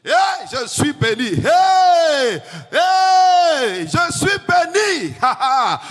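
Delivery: from loud to a full high shout; building to a shout. Shown as a demonstration. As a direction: rise up and shout it with joy. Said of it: "la voix ne fait que, le volume ne fait qu'augmenter". (« je suis béni » crié de plus en plus fort)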